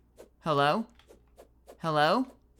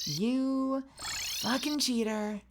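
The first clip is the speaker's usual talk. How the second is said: slow, higher, stretched insult; drawn-out taunt; 6 semitones higher, much slower than usual. Real, not acted. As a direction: stretch every word out.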